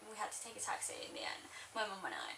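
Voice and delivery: whispered aside; hushed aside about the sister. Real, not acted. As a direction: drop to a whisper.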